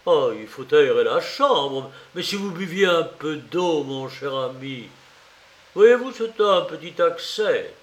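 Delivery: animated, solicitous urging; anxious fussing. A narrator's reading. Investigator offers water to his feverish visitor, fussing and concerned. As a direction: fuss over him with concern.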